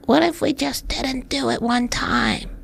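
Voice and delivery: a shy weakling daring to suggest; timid scrawny voice. Acted. Scrawny inner self pleading with the jacked guy, timid. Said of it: "this scrawny person who's like".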